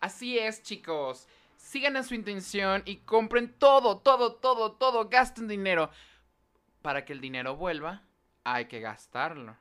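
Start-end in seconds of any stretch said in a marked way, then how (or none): 1.6-5.9 loud, rising salesy hype; excited. Acted. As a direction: sell it like an infomercial. Hyped sponsor plug.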